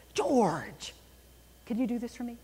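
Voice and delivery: "George" called out first with a falling tone, the rest lighter; stress on George. Shown as a demonstration. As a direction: lean on "George", then ease through the request. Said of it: "I emphasize George".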